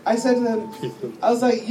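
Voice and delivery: normal talking voice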